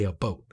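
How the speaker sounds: Canadian "about" with an oh vowel; "about" said as "a boat". Shown as a demonstration. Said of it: "Canadians say a boat".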